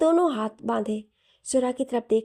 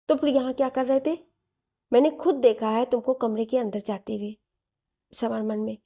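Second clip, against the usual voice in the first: ordinary delivery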